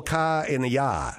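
Boston Yankee accent with dropped R's; Boston accent demo. Shown as a demonstration. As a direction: say it like a Bostonian, broad open vowels. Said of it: "he did a Massachusetts accent".

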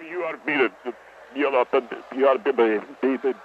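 stuttering, restarting the same few words; tongue-tied, halting stammer; nervous stuttering. Real, not acted. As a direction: stumble and repeat words nervously. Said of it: "what's with you stuttering".

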